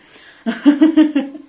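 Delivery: a bright, loud burst of laughter; high, hearty laugh at her own slip. Real, not acted.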